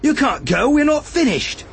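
high, rising angry cry; angry protest. A narrator's reading. Arad stopping villagers heading for safety, angry protest. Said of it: "An angry shout cut through".

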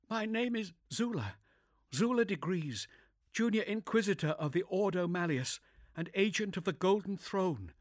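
composed but shaken inquisitor; young woman voice. Acted. Zula introduces herself.

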